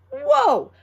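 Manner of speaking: high, loud amazed whoa; wow-struck child marvelling at her legs; amazed kid. A narrator's reading. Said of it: "I said".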